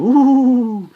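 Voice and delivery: high, drawn-out mock-ghost "wooo"; playful Halloween ghost wail, loud and high; spooky ghost woo. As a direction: hoot like a pretend ghost.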